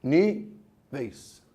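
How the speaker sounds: NÍ-VEIS, slow, with a break between syllables; separado em sílabas. Shown as a demonstration. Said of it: "como é que se separa em sílabas". ("Níveis" dividido em sílabas, NÍ – VEIS, devagar)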